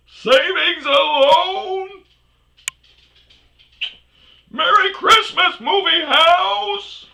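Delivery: very high, loud, slow crying Jimmy Stewart yell; high, tearful Christmas shout, stretched out. Retold or imitated. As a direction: bellow it like George Bailey running through town. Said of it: "the dude that was like".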